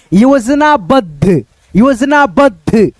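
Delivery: very slow, drawn out word; slow syllable reading. Shown as a demonstration. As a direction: sound it out slowly for students. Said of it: "तो कैसे पढ़ेंगे?". (धीमे स्वर में खींचकर)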